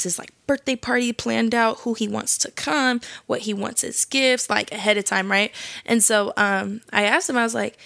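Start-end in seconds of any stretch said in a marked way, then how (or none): none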